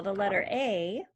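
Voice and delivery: normal talking voice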